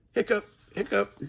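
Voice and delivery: monster hiccups. Acted.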